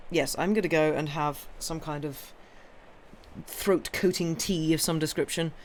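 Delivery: plain reading voice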